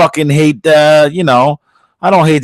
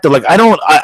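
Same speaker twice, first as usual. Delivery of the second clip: normal talking voice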